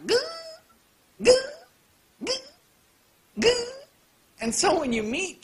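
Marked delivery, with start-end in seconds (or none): 0.1-3.7 goofy repeated dying "Gah!"; Jerry Lewis death yelp. Retold or imitated. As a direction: play it like slapstick. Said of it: "Jerry Lewis style".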